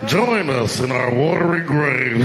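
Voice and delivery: slow, drawn-out ghostly call; badly hammed-up voice from the dead, stretched out long; dead Titanic passengers. The dead calling to an approaching submersible, ghostly impression. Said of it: "This is my impression of the dead people on the Titanic".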